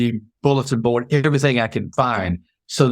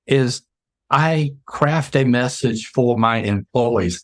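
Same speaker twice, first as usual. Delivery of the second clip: usual speaking voice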